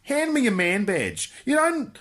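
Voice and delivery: usual speaking voice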